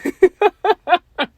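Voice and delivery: a loud, high amused laugh; burst of laughter. Real, not acted. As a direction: laugh it off, tickled by the comment. Amused by the troll's comment.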